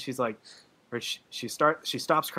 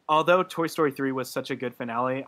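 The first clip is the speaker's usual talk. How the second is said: normal talking voice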